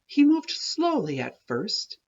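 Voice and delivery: ordinary delivery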